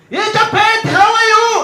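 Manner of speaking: high, loud, laughing boss impression; hammed-up boss greeting; mock boss voice. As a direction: greet him like an overexcited boss.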